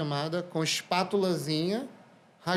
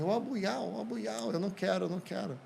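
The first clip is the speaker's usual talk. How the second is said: higher, softer imitation of a flustered waitress; a hint of a Chinese waitress turning him down; imitating the waitress; 4 semitones higher, 5 dB quieter than usual. Waitress refusing a customer she thinks is flirting, mock flustered. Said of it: "Aí ela falou assim".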